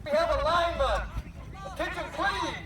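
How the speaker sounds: starter over loudspeaker; race announcer. Real, not acted. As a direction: call boats to the line.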